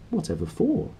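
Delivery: high, soft puzzled question; surprised priest. A narrator's reading. Priest asks Lyra why.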